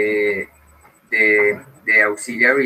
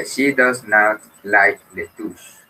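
slow model sentence; much slower than usual. Shown as a demonstration. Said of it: "Entonces sería".

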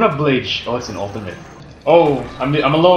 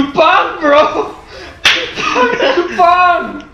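high, loud excited cry of the word; excited exclaim; 12 semitones higher, 3 dB louder, much slower than usual. Real, not acted. Gamer gleefully shouting a Bisaya word to his teammate. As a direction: belt out the word like a battle cry.

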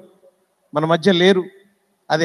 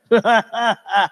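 imitated film-star laugh; put-on, measured chuckle in a hero's voice; Nageswara Rao laugh. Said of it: "ఇలా నవ్విన నాగేశ్వరరావు గారికి". (నాగేశ్వరరావు గారి నవ్వును అనుకరిస్తూ)

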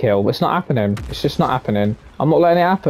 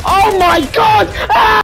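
high, drawn-out excited shriek; amazed screaming; 5 dB louder, slower than usual. Real, not acted. Gamer stunned by own play, amazed screaming. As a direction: scream it, slow and loud, in disbelief. Reacts to a sudden game moment.

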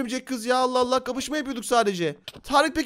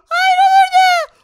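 put-on squeaky high-pitched spider girl, slow and sweet; girly high spider girl voice; 9 dB louder, slower than usual. Spider girl protests loudly. (çok ince sesli örümcek kız)

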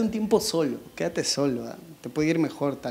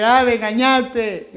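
put-on slow, higher drunken whine; mock drunk accusation; 4 semitones higher, much slower than usual. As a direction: parody a drunk man accusing. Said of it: "Llegar borracho y decir".

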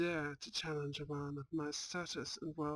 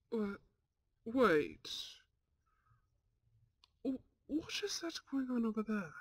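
surprised; 6 semitones higher than usual. Acted. Henry spots something odd.

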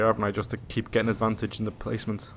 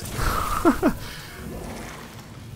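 breathless panicked laugh; 3 dB quieter than usual. Real, not acted.

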